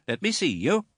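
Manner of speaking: put-on high, imperious old lady voice; stern aunt. A narrator's reading. Stern aunt sizing up the widow, high and imperious. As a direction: look down on her from a height. Miss Betsy orders the mother.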